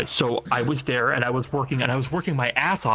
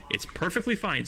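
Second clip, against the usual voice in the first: ordinary talk, nothing marked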